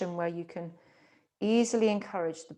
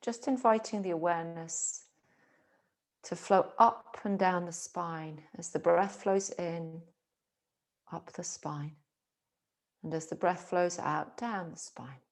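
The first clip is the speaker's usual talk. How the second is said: guided meditation. Acted. Teacher guiding awareness along spine and breath, calm meditation. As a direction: guide them gently into stillness, phrase by phrase.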